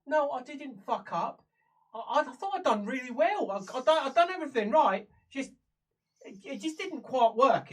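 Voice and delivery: high, loud protest; defensive prisoner. Acted. Prisoner defending her failed mission to her visitor, high-pitched protest. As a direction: insist you did well, pitched high.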